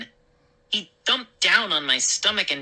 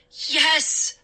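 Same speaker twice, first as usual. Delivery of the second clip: high, triumphant exclamation; cheering; 12 semitones higher than usual. Acted. Boy finally believed by father, cheering. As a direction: celebrate a win in one word. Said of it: "I cheered".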